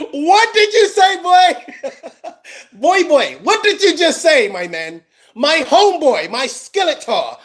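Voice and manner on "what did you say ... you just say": higher, slow, laughing disbelief; incredulous laughing. Real, not acted. Host mocking the guest's excuse, laughing and incredulous. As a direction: laugh it out, can't believe he said it. Reacting to absurd YouTube claim.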